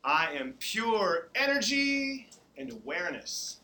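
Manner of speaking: slow, loud chanted affirmation. Acted. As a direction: lead the group slowly. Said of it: "leading the students in affirmations".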